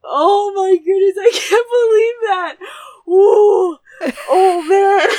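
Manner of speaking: high, loud, wailing exclamations; shocked disbelief. Real, not acted. Daughter still reeling from the strike, shocked. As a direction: let out the shock in bursts.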